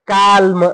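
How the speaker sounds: "काल्म" slow, with the L sounded; wrong pronunciation. Shown as a demonstration. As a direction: stretch "काल्म" out as kaal-muh. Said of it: "असं चुकते बाळा". ("काल्म", ल उच्चारून सावकाश)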